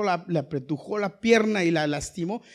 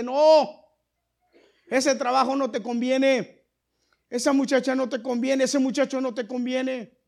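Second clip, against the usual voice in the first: insistent warning; 7 semitones higher, 6 dB louder than usual. Acted. The angel warning believers, loud insistent repetition. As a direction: warn again and again, insisting. Voicing God's warning, repeated.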